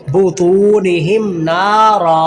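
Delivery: बुतूनिहिम नारा recited slowly, too and naa held; lengthened ta. Shown as a demonstration. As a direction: stretch the long vowels in बुतूनिहिम नारा. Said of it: "त्वा को लंबा करेंगे". (बुतूनिहिम नारा में 'तू', 'ना' लंबा)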